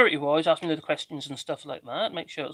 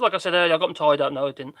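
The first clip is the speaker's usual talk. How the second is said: normal talking voice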